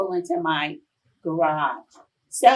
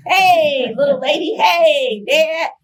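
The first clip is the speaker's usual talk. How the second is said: higher and louder, bubbly hellos; happy greeting; 7 semitones higher, 8 dB louder, slower than usual. Retold or imitated. Daughter and father greeting each other at the door, high and loud. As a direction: call out a joyful hello.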